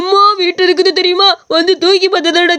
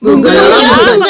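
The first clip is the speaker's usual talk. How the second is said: loud cheering shout; loud Pongal cheer; festive shout; 7 semitones higher, 6 dB louder than usual. Acted.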